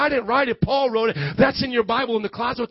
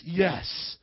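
ordinary talk, nothing marked

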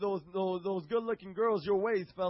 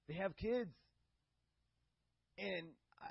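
ordinary talk

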